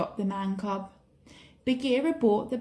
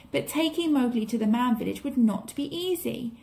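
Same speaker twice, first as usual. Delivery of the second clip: ordinary delivery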